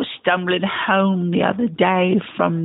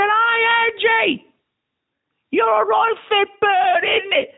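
higher, calling out in a fake Cockney accent; mock British shout; 13 semitones higher, slower than usual. Calling out to Angela Lansbury at the stage door, fake British accent. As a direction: hail her across the street, mock British.

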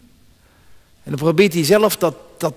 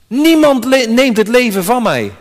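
proclaiming Jesus; 5 semitones higher, 5 dB louder than usual. Acted. Quoting Jesus in John 10.

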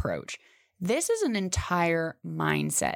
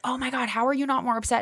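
friends' surprise; 6 semitones higher, faster than usual. Retold or imitated. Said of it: "my friends were like".

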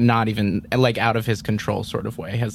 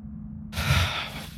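soft, breathy exhale; resigned sigh; 7 dB quieter than usual. Acted. Ward giving in as Kumari hugs him, resigned sigh. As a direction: let a long breath go.

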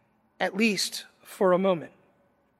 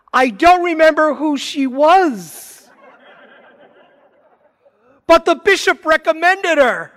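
louder, higher, flustered rush with a laugh at the end; flustered panic; 8 semitones higher, 8 dB louder than usual. Retold or imitated. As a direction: scramble to fix a blunder, half laughing. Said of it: "he realizes he's blown it".

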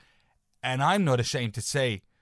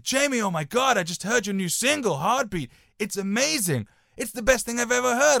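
much higher and louder, gushing praise; raving friend; 10 semitones higher, 5 dB louder than usual. Retold or imitated. Sergio raving about Jamie's new single, excited. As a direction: rave like a thrilled fan. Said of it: "I'm g-, not gonna do his accent".

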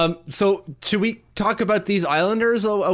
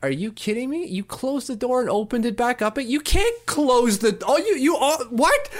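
high, incredulous sputter; retold inner outrage, pitched high and stumbling; freaking out inwardly; 8 semitones higher than usual. Reacting in his head to the neighbor reopening the door, incredulous. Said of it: "I'm freaking out in my head".

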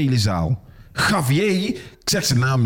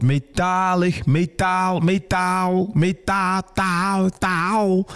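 higher, slow, fading echo of a soundcheck; mock echo soundcheck; 7 semitones higher, much slower than usual. Mocking the other comedian's soundcheck, higher echo voice. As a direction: parody the echoing mic test, slow and high.

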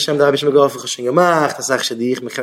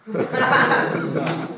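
high, soft wheezy burst of laughter; 9 semitones higher, 6 dB quieter than usual. Real, not acted. Speaker amused by his matchmaker money joke, laughing. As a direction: laugh at your own joke.

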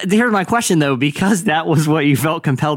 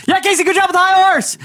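high, hollered audience plug; heckler shout; 3 dB louder than usual. Acted. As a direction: holler praise from the back row. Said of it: "right as the last chord rings out".

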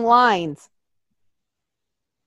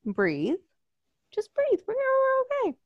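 quiet, much higher, coaxing; self-soothing; 14 semitones higher, 9 dB quieter than usual. Retold or imitated. Calming herself during a panic attack, softly. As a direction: talk yourself down gently. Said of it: "But I was like".

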